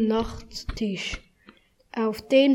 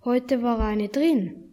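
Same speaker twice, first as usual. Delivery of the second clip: plain reading voice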